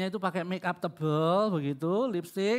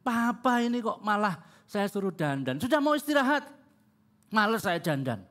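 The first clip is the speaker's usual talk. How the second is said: higher-pitched mock indignation, easing at the end; retold annoyed wife; 4 semitones higher than usual. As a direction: act out an exasperated wife protesting. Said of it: "mamanya tambah jengkel ya".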